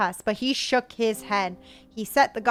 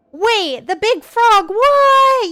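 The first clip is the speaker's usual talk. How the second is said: surprised outburst; 11 semitones higher, 8 dB louder, much slower than usual. Real, not acted. Reader interrupting the story in shock, loud high disbelief. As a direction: pitch shoots up and gets louder with each word.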